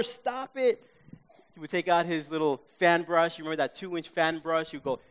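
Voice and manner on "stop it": high-pitched mock horrified protest; hammed-up plea, pitched high; retold horror. Viewer begging the TV painter, mock horror. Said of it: "I'm in horror".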